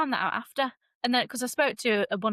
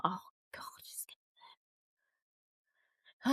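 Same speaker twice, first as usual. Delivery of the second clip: anxious whisper; 9 dB quieter than usual. Retold or imitated. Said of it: "I'm like".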